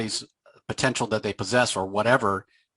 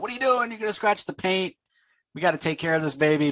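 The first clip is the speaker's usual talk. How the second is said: alarmed fussing; 8 semitones higher, faster than usual. Retold or imitated. Sam fussing over the car.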